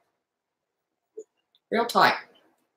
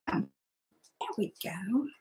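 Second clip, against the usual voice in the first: whisper; 15 dB quieter than usual. Real, not acted. Finishing the craft step.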